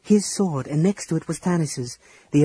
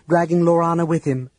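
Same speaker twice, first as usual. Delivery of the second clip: ordinary delivery, nothing marked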